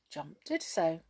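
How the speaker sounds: plain reading voice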